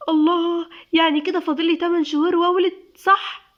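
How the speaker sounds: put-on high, quick, girlish excitement; childlike delight. A narrator's reading. Newly pregnant wife asking the doctor, with childlike delight. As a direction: gush like an excited little kid. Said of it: "نور بطفولة".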